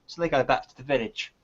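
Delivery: normal talking voice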